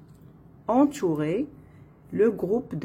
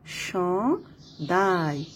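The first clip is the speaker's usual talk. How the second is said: "Chandail" broken as chan, pause, dail; split into syllables. Shown as a demonstration. Teacher showing there are two syllables, split into syllables. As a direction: say "Chandail" slowly in two separate beats. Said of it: "Donc, deux syllabes".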